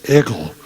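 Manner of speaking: "Eagle" said "Iggle", with a short i and a hard g. Shown as a demonstration. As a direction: say "Eagle" as "IG-gle", Bronx style. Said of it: "not the E A G L E, but the I G G L E".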